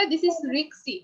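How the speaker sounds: "risky" with K and S swapped, rik-si; common mispronunciation. Retold or imitated. Teacher showing what most people say, mispronounced rik-si. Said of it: "বেশিরভাগ মানুষ কিন্তু বলে".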